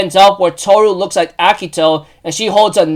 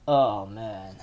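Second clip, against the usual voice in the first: very low, barely voiced groan; hushed dread; 11 semitones lower, 15 dB quieter than usual. Fan reacting to next episode's title, hushed dread. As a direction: sink right down, soft and deflated.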